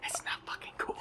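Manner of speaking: it's not fucking cool whispered very soft, no voice. Real, not acted. Whispering to James about the game, a furious whisper.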